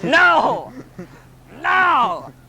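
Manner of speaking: loud, high drawn-out cry; anguished shout. Acted. Teller realizing they are busted, high howl of protest. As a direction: wail it at full voice.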